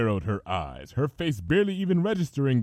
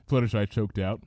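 plain reading voice

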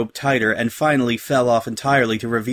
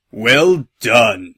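loud, drawn-out sarcastic praise; menacing captain's mock compliment; dripping malice; 5 dB louder than usual. A narrator's reading. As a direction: congratulate them with venom. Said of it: "his voice dripping with the kind of malice".

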